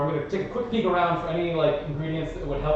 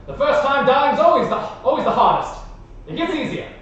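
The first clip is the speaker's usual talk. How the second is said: encouraging in-character line; 9 semitones higher, 5 dB louder than usual. Acted. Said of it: "say encouraging words like".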